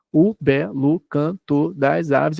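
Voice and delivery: slow, syllable by syllable; slow verse scansion. Shown as a demonstration. Teacher spells out the verse to students, slow and measured. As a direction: read the line slowly, counting each beat. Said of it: "Qual é a contagem de sílabas aqui?".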